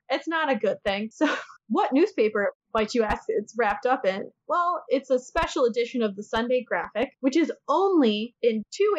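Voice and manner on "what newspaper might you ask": ordinary delivery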